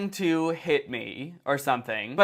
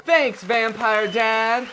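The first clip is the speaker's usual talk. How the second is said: sarcastic thanks; 4 semitones higher, 4 dB louder, much slower than usual. Acted.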